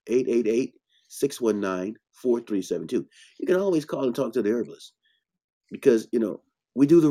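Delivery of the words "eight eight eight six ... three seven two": slower, spaced-out phone number; slowed down so it can be written down. Shown as a demonstration. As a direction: read the number out in separate groups. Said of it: "Let me say that slower".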